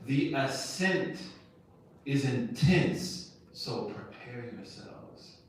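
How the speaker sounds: slow, weighty words; the Prophet warns his companions of the hard climb ahead; solemn quote. Retold or imitated. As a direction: let each phrase land.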